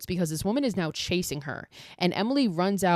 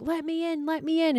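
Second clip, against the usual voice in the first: put-on creepy little girl; 10 semitones higher than usual. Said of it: "So Lily's just like".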